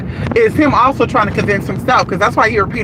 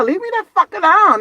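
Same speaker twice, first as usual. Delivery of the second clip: exasperated; 10 semitones higher than usual. Real, not acted. Fed up with callers.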